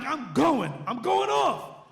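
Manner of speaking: loud, high, pumped-up declaration; fired-up resolve. Retold or imitated. DJ hears the star is here, loudly fired up. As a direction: punch it out, rising in excitement.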